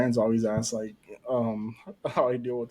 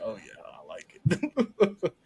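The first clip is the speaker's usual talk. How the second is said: slow words through a laugh; 5 semitones higher, much slower than usual. Retold or imitated. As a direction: grin and admit it, laughing. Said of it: "I was like".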